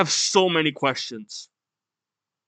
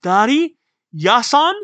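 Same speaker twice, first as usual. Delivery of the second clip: raised, louder questioning calls; child calling out; 7 semitones higher, 5 dB louder than usual. Acted. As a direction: call for someone, rising each time.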